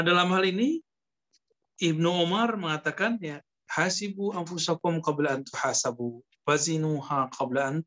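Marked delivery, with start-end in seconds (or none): none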